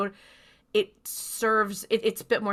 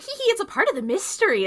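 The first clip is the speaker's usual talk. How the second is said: high, fast, louder tittering mockery; mock giddy glee; 12 semitones higher, 5 dB louder, faster than usual. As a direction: mimic a silly, giggly tease, pitched way up. Mocking frivolous TV writing's attitude.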